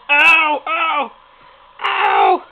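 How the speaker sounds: loud sharp ow; pained yelps. Real, not acted. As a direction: yelp as the puppy bites. Puppy biting toes.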